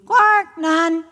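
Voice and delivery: high croaking frog voice. Acted. Frog character voice.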